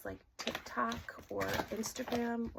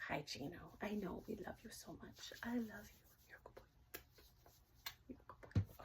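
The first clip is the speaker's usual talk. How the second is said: tender whisper to dog; 8 dB quieter than usual. Real, not acted. Owner soothing her dog.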